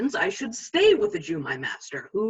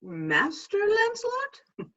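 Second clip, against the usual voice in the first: slow, higher, rising tease to the father; teasing question; 8 semitones higher, slower than usual. Acted. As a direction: bait the old man. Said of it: "Mark me now, now will I raise the waters".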